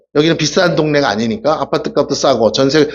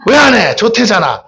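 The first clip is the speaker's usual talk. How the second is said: congregation prompt; 5 semitones higher than usual. Shown as a demonstration. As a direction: call it out for all to repeat. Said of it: "시작". (교인들에게 따라 하라며 크고 높게 외치기)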